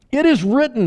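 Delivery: Jesus proclaiming. Acted. Jesus declares it to the temple sellers, high and loud. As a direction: ring out high and loud like a prophet. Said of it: "he said to them".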